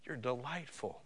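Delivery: soft, intimate reassurance; hushed, loving tone; tender affirmation. Acted. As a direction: say it gently, as the Lord to a loved child. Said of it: "what the Lord is saying to you today".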